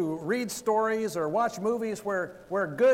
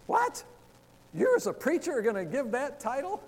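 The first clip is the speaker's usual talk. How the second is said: high-pitched incredulous imitation; mock disbelief; 6 semitones higher, 3 dB louder than usual. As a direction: balk at it like a stunned brother. Brother's reaction to the sermon title.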